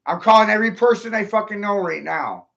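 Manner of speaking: ordinary talk, nothing marked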